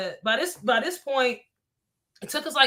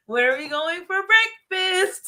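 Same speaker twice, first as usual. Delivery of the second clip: high-pitched, hammed-up cheerfulness; chipper morning energy; 7 semitones higher than usual. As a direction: imitate your sunny morning self. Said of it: "That was the energy".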